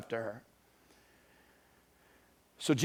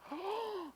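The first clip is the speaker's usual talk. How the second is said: short startled gasp, very high; high breathy startled gasp; sharp high gasp; 12 dB quieter than usual. Acted. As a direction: gasp as if caught out. Woman reacts to being known.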